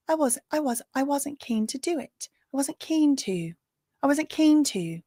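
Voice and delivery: trying on a Hermione-style British English voice; mock British accent. Host reads the assistant's testimony in a mock British accent. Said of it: "I gotta get in my Hermione Granger".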